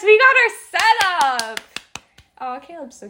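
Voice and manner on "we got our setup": higher, louder, slow excitement; excited. Real, not acted. Watching old video.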